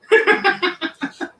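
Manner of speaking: high-pitched laugh. Real, not acted. Laughing at himself.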